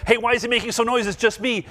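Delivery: usual speaking voice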